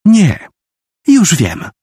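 put-on high, sudden delighted girl; light up with an idea; beaming. A narrator's reading. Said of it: "Nagle się rozpromieniła".

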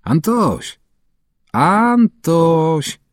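high calling voice, searching; grandma calling. A narrator's reading. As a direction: call out for the boy, high and drawn. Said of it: "Woła babcia".

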